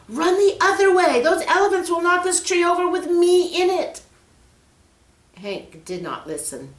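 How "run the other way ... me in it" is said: high, loud frantic warning; panicked shout. Acted. Peter hanging from tree shouting warning to Hank, panicked. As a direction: shout it, terrified.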